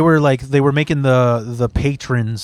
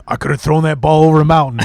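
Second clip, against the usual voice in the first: fast, cocky jock bro boast; faster than usual. Retold or imitated. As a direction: brag like a meathead jock.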